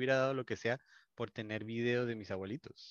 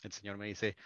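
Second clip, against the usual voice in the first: normal talking voice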